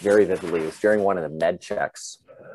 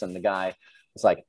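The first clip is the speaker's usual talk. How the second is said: ordinary talk, nothing marked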